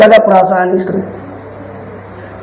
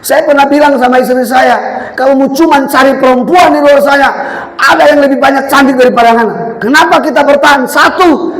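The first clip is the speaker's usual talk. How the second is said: higher, driving preaching shout at the end; fervent preaching; 6 semitones higher than usual. Acted. Preacher telling wife she is the best, fervent shout. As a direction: declare it with conviction.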